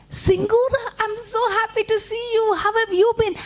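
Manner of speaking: very high little spider voice; delighted Anansi. Acted. Anansi greets rabbit back, high excited voice. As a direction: squeak a happy greeting to a friend. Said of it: "And Anansi said".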